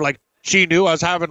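ordinary talk

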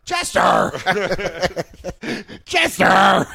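mock shout for Chester; calling out. Friend pretends to call for Chester, mock shout. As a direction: call the name out across the yard.

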